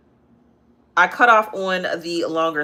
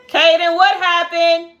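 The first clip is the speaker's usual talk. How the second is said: high, slow, loud call to her son; 14 semitones higher, 9 dB louder, slower than usual. Real, not acted. Mom calls out to her hurt son, loud and high.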